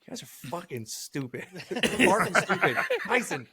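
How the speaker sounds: ordinary talk, nothing marked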